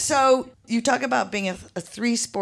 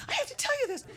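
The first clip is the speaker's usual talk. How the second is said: excited whisper-ish; 8 dB quieter, much faster than usual. Retold or imitated. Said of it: "And she's like".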